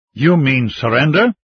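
indignant, incredulous question, mildly angry; understated angry challenge, rising on surrender. A narrator's reading. Zhang challenging a comrade's talk of ending hostilities, angrily. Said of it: "Zhang asked angrily".

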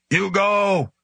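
high-pitched rasping ape command; an angry gorilla forcing out human words. A narrator's reading. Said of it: "it said in a rasping voice".

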